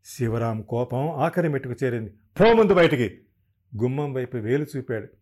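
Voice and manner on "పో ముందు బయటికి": loud, higher shouted order; furious shout. A narrator's reading. Husband ordering his wife out of the house, furious shout. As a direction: roar it at her, pointing to the door. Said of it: "ఎందుకలా అరుస్తారు?".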